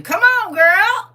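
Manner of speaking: high, loud joyful shout; excited shout. Real, not acted. Teacher cheering a student's praise, loud joyful shout. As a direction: cheer her on at full voice.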